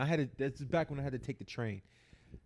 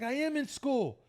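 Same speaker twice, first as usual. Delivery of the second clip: high-pitched, exasperated comeback; indignant protest retold; 13 semitones higher, 4 dB louder, slower than usual. Answering a cop drilling him about school, high indignant protest.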